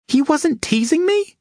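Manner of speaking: startled high squeak of disbelief. A narrator's reading. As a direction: squeak it out in shock. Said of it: "she squeaked".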